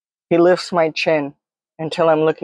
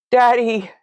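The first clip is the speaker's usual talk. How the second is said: understated scream for a parent; screamed cry; 6 semitones higher than usual. A narrator's reading. Panicking child screams for Daddy, hurt, desperate cry. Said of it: "The scream tears out of me".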